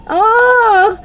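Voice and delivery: high, sustained crying wail; huge wail. A narrator's reading. Heartbroken teacher outside the classroom, huge wail. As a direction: wail out high in theatrical despair. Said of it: "they heard a huge wail".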